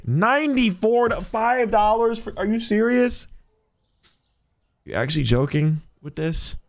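high, incredulous are you serious; jolted up in pitch, can't believe it; shocked disbelief. Real, not acted. Cashier stunned by a customer's cash, high disbelief.

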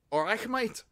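high, soft Scouse impression; Liverpool accent. Man showing friends how Liverpool talks, Liverpool accent.

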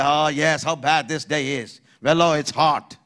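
a hint of a gloomy complainer; negative person. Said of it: "and he'll say".

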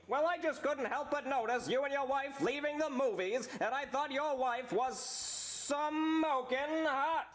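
schmoozy Hollywood agent pitch; Steve Staleberger voice. Acted. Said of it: "talent agent Steve Staleberger".

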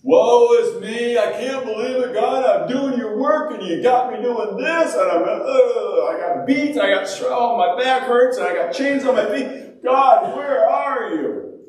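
mock whining, pitched high and dragging on the big cries; self-pitying wail. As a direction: throw a hammed-up pity party. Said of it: "we'd be having a pity party".

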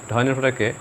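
ordinary delivery

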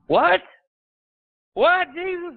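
high, loud disbelieving cry of what; incredulous what. Acted. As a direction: throw out a stunned, mocking what?. Preacher voices disbelief at Jesus.

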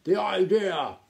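loud, high, indignant protest; snapping duke. A narrator's reading. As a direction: scoff at the whole idea. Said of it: "snapped the Duc de Haberdashery".

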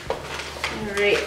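normal talking voice

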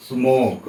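স্মোক with a long o, ending on k; silent final e. Shown as a demonstration. Teacher showing that e is silent, স্মোক with a drawn-out o. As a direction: say স্মোক clearly, no vowel after the k. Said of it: "ই উচ্চারণ হবে না".

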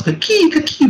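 high-pitched creature call; creature cry. Acted. Game master giving the creatures' only reply, high creature cry. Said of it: "The only thing they said was".

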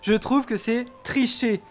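loud protest. Pretend. Player appeals to the judges over a bet, protesting loudly. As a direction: plead your case loudly to the judges.

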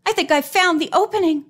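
high, fast, excited announcement. A narrator's reading. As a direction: blurt out an exciting discovery. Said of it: "she said excitedly".

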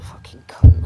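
harsh whisper of contempt; whispered curse. Real, not acted. Vlogger ends the rant, whispering a curse at the reviewer. Final insult after quitting the rant.

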